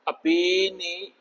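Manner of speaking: अपीनी very slow, syllable by syllable; slowly drawn-out अपीनी; broken-down sounds. Shown as a demonstration. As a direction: stretch अपीनी to show its short i. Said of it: "आई की तो sound तो है".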